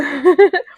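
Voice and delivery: short high burst of laughter; sudden high giggle; high bright laugh. Real, not acted. Delighted by card line.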